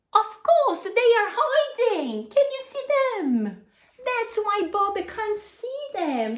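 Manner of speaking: gleeful storyteller's reveal; playful excitement. Real, not acted. Playful reveal of hiding aliens.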